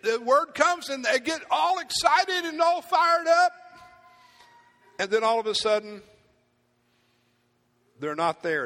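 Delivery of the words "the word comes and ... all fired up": much higher, loud, slow preaching shout; preacher shouting to the congregation about getting fired up. Real, not acted. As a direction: lift the room as you hit the peak. Preacher builds to a peak.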